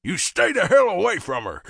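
put-on loud, high southern-accented warning; angry southern shout. A narrator's reading. Southern father warning biker off his daughter, shouting.

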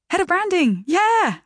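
high, bright, squeaky blurt; yelped. Acted. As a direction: yelp it out too eagerly. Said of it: "I yelped".

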